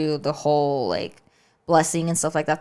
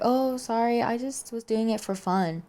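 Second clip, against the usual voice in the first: lightly voiced sheepish grandma, higher pitched; high, soft, innocent-sounding excuse; apologetic grandma; 6 semitones higher, 3 dB quieter than usual. Grandma excusing herself to her son for scaring grandkids, lightly mimicked apology.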